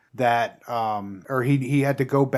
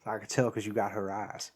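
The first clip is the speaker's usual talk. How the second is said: soft, lower, badly faked film-star voice; Matthew McConaughey; 5 semitones lower, 13 dB quieter than usual. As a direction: try a bad impression, low and soft. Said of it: "That's not, that's a bad".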